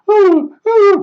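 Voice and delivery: high falsetto mimicry of a fussy woman; mocking the neighbor. Imitating the neighbor woman, high falsetto. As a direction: squeak it out like the silly neighbor lady. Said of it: "that's how she used to talk".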